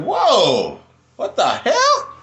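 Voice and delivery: a high, astonished whoa with a laugh; retold amazed surprise. Reacting to the juice's effect a day later, amazed and laughing. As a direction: relive the shock of feeling the energy hit. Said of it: "I was like".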